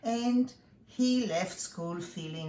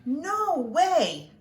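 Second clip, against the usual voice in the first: high, loud, gleeful cry; big excited no way; excited shout; 8 semitones higher, 6 dB louder than usual. A narrator's reading. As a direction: burst out with joy for the kids. Exclaimed answer to story question.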